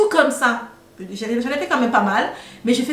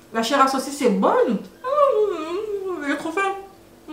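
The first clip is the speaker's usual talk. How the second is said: talking through a mouthful; chewing while talking; mouth full; 5 semitones higher, 3 dB quieter, slower than usual. Real, not acted. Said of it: "Pardonnez-moi de parler avec la bouche pleine".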